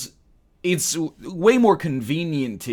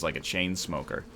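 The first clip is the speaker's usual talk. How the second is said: usual speaking voice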